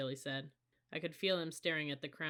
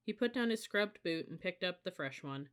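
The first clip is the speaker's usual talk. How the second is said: ordinary delivery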